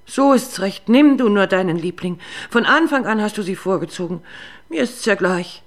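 bitter accusation. Acted. Jealous wife accuses husband.

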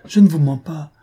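woman swearing to her lover she isn't lying, loud and high; desperate protest. A narrator's reading. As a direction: swear you're telling the truth. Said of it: "reprit-elle".

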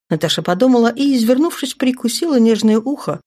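plain reading voice

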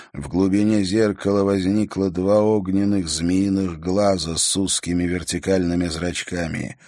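ordinary delivery

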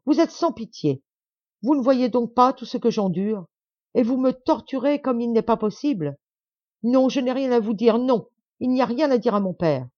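high, loud, pleading and overwrought; distraught outburst. A narrator's reading. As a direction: pour it out in distress. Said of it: "s'écria-t-elle".

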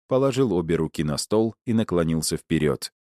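ordinary delivery

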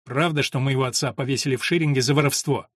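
usual speaking voice

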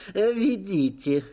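put-on high, drawn-out childish demand; put-on demanding kid voice. A narrator's reading. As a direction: order them like an insistent little kid. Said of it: "добавил тоном требовательного ребёнка".

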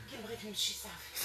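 distant, hushed possessed voice; thick strong voice. Real, not acted. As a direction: murmur it like a spirit speaking through her. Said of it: "بصوت غليظ قوي".

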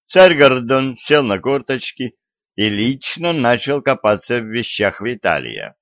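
ordinary delivery

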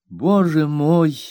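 slow, high dismayed cry; mother exclaims, slow and hurt; exclaiming. A narrator's reading. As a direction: gasp it out in dismay. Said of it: "воскликнула моя мать".

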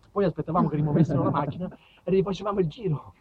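usual speaking voice

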